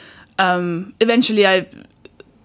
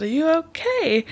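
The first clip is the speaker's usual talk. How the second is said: gentle concern; 9 semitones higher, slower than usual. Retold or imitated. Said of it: "I said".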